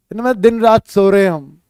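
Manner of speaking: ordinary talk, nothing marked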